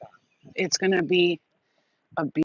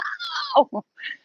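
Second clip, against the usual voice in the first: squealing laugh far up high; high-pitched laugh. Real, not acted. Laughing at how she reacts to bugs, high squeal. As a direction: laugh at the silly panic. Laughing at her own bug panic.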